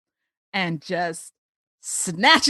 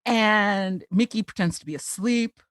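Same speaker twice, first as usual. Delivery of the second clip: ordinary talk, nothing marked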